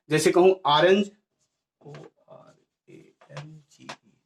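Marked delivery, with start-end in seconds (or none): 1.8-4.1 very quiet, slow letter-by-letter spelling; spelling aloud. Shown as a demonstration. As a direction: murmur each letter slowly, as if writing it. Spelling word while writing it. (धीरे-धीरे, दबी आवाज़ में एक-एक अक्षर)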